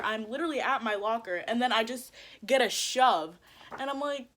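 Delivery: normal talking voice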